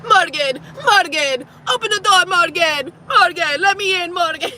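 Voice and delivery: high, insistent calling of her name; imitating him calling. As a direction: pester her to open the door. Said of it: "he's at my window".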